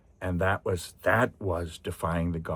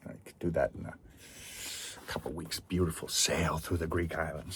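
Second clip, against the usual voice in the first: soft aside; 3 semitones lower, 8 dB quieter than usual. Real, not acted. Dreamy aside.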